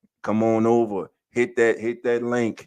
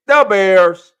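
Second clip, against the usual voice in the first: loud, high Chicago superfan bellow; Chicago fan catchphrase; 6 semitones higher, 12 dB louder than usual. Retold or imitated. Host imitates how Chicago says it, loud Chicago bellow. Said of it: "That's how they say it".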